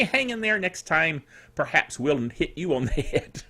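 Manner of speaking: normal talking voice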